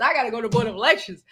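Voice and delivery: rattled off fast; rushed. Retold or imitated. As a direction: hurry through it fast. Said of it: "I'm like".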